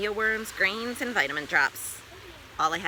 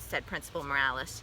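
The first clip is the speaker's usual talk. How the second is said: ordinary delivery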